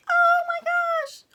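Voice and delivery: very high, loud, stretched squeal; shrieking with delight. Real, not acted. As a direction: squeal it slowly at the top of your voice. Delighted at tiny gifts in box.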